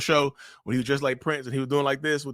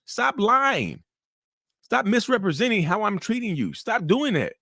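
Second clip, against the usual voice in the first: higher-pitched, insistent self-justifying protest; narcissist voice; 6 semitones higher than usual. Acted. Narcissist denying mistreatment to the one who came back, indignant protest. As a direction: argue back as the accused, pitched up. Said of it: "That's the mind of a narcissist".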